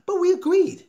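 "we agreed" shouted in triumph; triumphant cry. Real, not acted. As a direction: belt it out as a win. Triumphant deal announcement.

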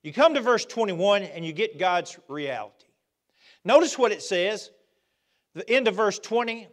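fervent sermon delivery; preaching. Acted. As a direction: drive it home from the pulpit. Preaching at full force.

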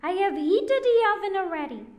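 high, loud, brisk witch voice; bossy witch. A narrator's reading. Witch planning the oven, high and loud. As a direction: rattle off orders like a scheming witch.

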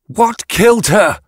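high, forceful interrogation; harsh, threatening. A narrator's reading. Yatena questions the only witness, harsh and threatening. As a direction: demand it with menace, pitched high. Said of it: "voice harsh, threatening".

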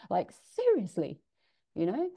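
normal talking voice

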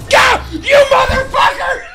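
high, loud victory scream; triumphant yell. Real, not acted. Streamer screaming at his opponent, high loud triumph. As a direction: roar it out as you win.

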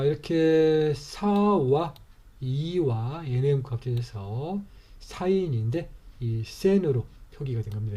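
normal talking voice

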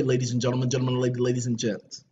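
low rumbling showman intro; deep announcer. Acted. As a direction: drop the voice way down for the announcement.

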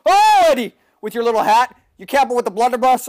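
high and loud mock-scolding; teasing call-out. Real, not acted. Gamer teasing a camping enemy, high and loud. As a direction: sing it at him playfully.

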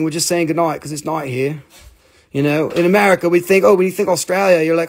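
ordinary talk

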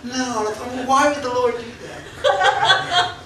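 high, slow mock protest; mock embarrassment. Preacher replaying his embarrassment on stage, mock-mortified. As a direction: play up being mortified. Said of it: "And I'm so embarrassed".

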